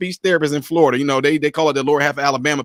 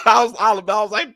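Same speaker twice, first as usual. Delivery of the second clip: usual speaking voice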